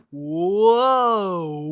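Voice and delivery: long, low, loud whoa. Retold or imitated. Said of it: "And I was like".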